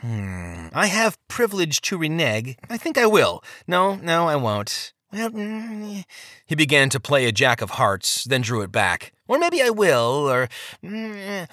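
wavering voice leaping high then sinking to a murmur; dithering little man. Acted.

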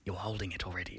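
whispered aside. Real, not acted. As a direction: hiss it off-mic. Aside not heard by audience.

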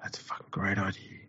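hushed mock enthusiasm in a whisper. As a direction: pretend awed whisper. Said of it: "So why are we all whispering?".

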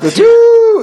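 loud high horn note with a laugh; shofar blast. Shown as a demonstration. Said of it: "That's not a good heartbeat".

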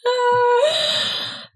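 squealing burst of laughter; high laugh. Real, not acted. Laughing at the puffin call.

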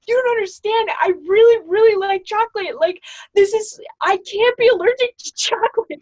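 high, loud, near shouting distress; panicked. Retold or imitated. Roommate pleading that she can't be allergic, high and loud. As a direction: plead like your favorite food is gone.

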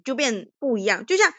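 normal talking voice

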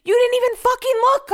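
very high, loud accusation; outraged yell, acted out; retold screaming. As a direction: re-enact screaming at a careless driver. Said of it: "but I was screaming".

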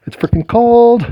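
cold, squealing high complaint; high exasperated yelp. Real, not acted. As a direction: shriek it out against the cold. Repeats louder and higher.

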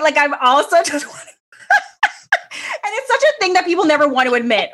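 laughs through the words, pitch jumping up; giggling as she owns up to it; laughing. Real, not acted. Admitting to her co-host she buys to show off, laughing. Laughing while confessing a habit.

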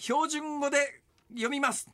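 mock announcement. Shown as a demonstration.

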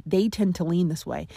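ordinary delivery

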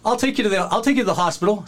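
high, quick helpful offer; eager promoter. Retold or imitated. Promoter offering the injured wrestler a ride, high eager offer. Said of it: "he's like".

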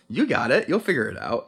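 ordinary delivery

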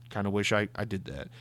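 low, very soft aside; small, under-the-breath mock regret; sheepish admission. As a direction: drop right down as if admitting it to yourself. Imagines his own sheepish envy.

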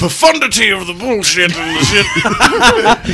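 high, loud, slow slurred parody; hammed-up drunken ramble, dragging the words; loud mock-drunk impression; drunk Bill Cosby. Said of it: "You sound like a drunk Bill Cosby".

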